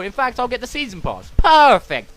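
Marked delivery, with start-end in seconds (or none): 1.4-2.2 a loud, pleased sales pitch; mock delighted seller. Game store voice loudly thrilled with the sale. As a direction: bark it out like a happy shopkeeper.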